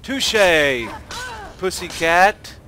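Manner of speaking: loud, high fight yells; battle cries. Acted. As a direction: shout each hit. Fighting vampire.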